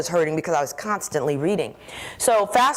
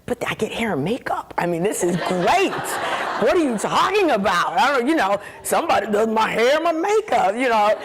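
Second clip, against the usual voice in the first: excited protest; 4 semitones higher than usual. Retold or imitated. To the executive, a playfully gushing protest. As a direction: gush about the perks, playful. Gushing about hair and makeup.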